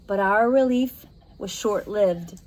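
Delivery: plain reading voice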